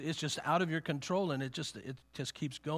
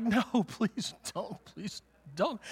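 slow, half-laughing plea; mock pleading; 5 semitones higher, much slower than usual. Retelling begging the song to stop, mock plea. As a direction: beg them to stop, laughing at yourself. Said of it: "and I'm like".

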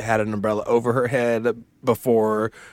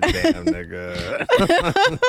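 very slow, stretched out through a laugh; hammed-up impressed drawl; slow drawn-out awe; 5 semitones higher, much slower than usual. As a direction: savor it like a stunned look. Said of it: "the look was giving".

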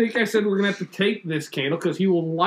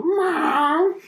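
high rising mock meow; cat meow; 14 semitones higher, 5 dB louder than usual. Telling a friend about the cat, high mock meow.

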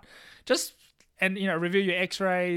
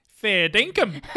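higher, louder overdone Aussie; cringe-worthy fake Australian; bad Australian accent; 5 semitones higher, 10 dB louder, much slower than usual. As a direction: ham up a terrible Aussie twang. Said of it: "That's horrible".

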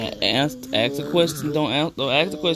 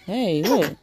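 normal talking voice

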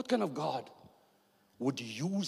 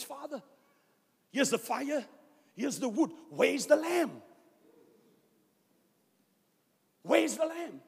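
put-on higher, pleading boy's voice; young Isaac asking; 13 semitones higher, 4 dB louder, slower than usual. Isaac asking his father on the mountain, higher pleading voice. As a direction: ask like a puzzled son, higher.

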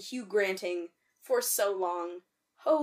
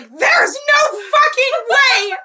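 very high, slow, drawn-out yell; hammed-up disbelieving shout; 13 semitones higher, 14 dB louder, slower than usual. Host relives spotting another film reference, shouting in mock despair. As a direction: wail each word in mock despair.

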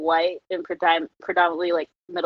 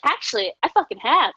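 smug comeback; 6 semitones higher, 3 dB louder than usual. Retold or imitated. Triumphant comeback.

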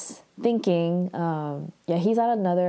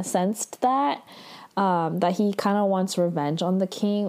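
normal talking voice

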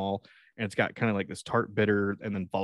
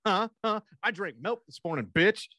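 mock tree rage; 7 semitones higher than usual. Imagined dad raging at tree.

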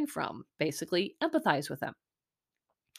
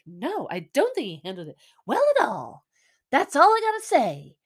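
dismissive, rising to a high loud finish; mocking imitation; 6 semitones higher, 4 dB louder than usual. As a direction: parrot her answer, snotty and shrill at the end. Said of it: "who's just like".

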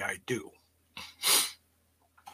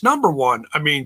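ordinary talk, nothing marked

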